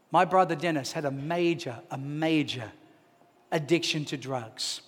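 low, soft, grave storytelling; sombre reveal. Real, not acted. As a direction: let the hard truth land quietly, with pauses.

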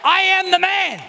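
lampoon a husband lording it over his wife; mock domineering husband. Preacher mocking a husband ordering his wife around, hammed-up bossy shouting. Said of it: "you can go home and go".

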